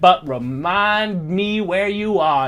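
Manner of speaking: a hint of a Southern colonel accent; Southern gentleman accent. GM voicing Jedediah to the party in a Southern accent. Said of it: "Literally Foghorn Leghorn".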